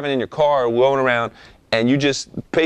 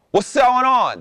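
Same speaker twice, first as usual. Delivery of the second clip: middle tone; 12 semitones higher, slower than usual. Shown as a demonstration. Rapper demos his middle tone for students. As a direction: call it out in your middle range. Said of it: "That's at my middle tone".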